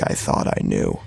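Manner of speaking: ordinary delivery, nothing marked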